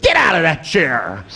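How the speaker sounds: high, forceful mock shout; shouted order. Comic overrules nurse to seated patient, high shouted command. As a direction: yell a counter-order at the patient.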